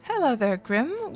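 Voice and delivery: ordinary delivery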